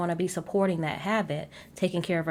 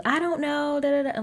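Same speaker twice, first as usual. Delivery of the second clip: coy dodge; 10 semitones higher than usual. Retold or imitated. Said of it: "She goes".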